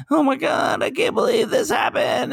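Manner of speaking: very high-pitched mock wailing; hammed-up kid meltdown; upset child. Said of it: "they're gonna be getting upset sometimes".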